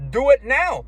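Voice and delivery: high, loud insistent order; mock command. Partner on the bed insists, a high loud command. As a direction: push him hard to do it now. Imagined partner demanding performance.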